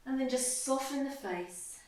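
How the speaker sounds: guided meditation. Acted. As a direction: guide them gently into stillness, softening as you go. Leads guided body-scan meditation.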